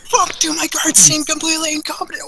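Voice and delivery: high, exasperated ranting; outraged disbelief. Acted. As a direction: fume at the useless guards, pitched high. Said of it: "and he goes".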